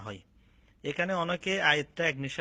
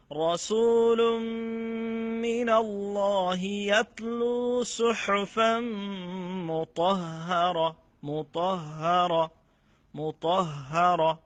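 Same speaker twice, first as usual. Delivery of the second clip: slow, higher, louder melodic tilawat; Quran recitation; 5 semitones higher, 5 dB louder, much slower than usual. Acted. Teacher reciting the verse for students, slow melodic chant. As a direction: chant it with long held vowels.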